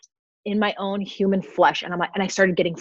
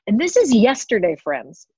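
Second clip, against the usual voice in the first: ordinary talk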